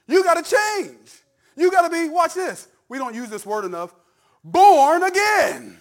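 high, shouted preacher's exhortation; full-force preaching. Real, not acted. Pastor urging the congregation to be born again, preaching at full force. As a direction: cry it out to the congregation, stretching the key words.

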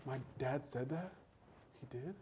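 very soft, stunned questions; hushed disbelief. Acted. Joseph hearing his brothers' claim, hushed disbelief. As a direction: let the voice drop almost to nothing.

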